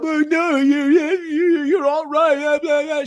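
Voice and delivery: high, fast squeaky mocking voice; mock whiny critic. Mocking the critics who dodge the question, in a high whiny voice.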